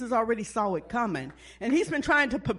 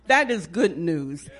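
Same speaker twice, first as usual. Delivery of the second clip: ordinary delivery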